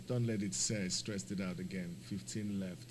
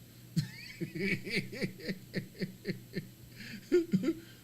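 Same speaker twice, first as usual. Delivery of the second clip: laugh; 11 semitones higher than usual. Real, not acted. Laughs after Spirit joke.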